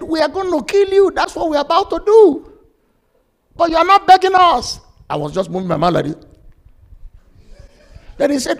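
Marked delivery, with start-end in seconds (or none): 0.0-4.8 high, loud threat; acted killer's threat, rising to a shout; threatening assassin. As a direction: raise pitch and volume to intimidate a captive. Hired assassin threatens the captive preacher.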